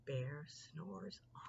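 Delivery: hushed whisper, so the bear sleeps. A narrator's reading. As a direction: whisper it to not wake him. Sleeping bear, quiet refrain.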